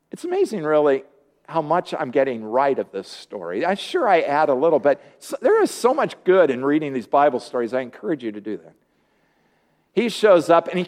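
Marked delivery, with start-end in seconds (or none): none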